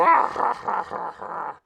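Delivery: loud, high-pitched put-on cackle; exaggerated laugh. Host reading about a trade war with everyone, exaggerated laugh. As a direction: burst into a big, high mock laugh.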